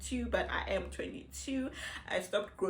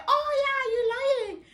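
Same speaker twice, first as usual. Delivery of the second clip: mock accusation; 6 dB louder than usual. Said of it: "Are you gonna say".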